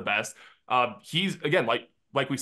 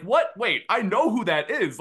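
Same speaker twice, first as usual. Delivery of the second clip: high-pitched retold double take; surprised recognition; 10 semitones higher, 4 dB louder than usual. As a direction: act out a startled realisation, voice jumping up. Retold reaction to seeing a familiar face.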